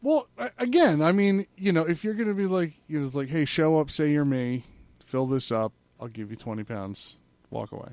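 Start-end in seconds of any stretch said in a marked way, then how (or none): none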